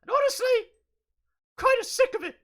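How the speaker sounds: put-on high little kid voice; small child voice. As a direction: whine like a fed-up child. Pablo voice.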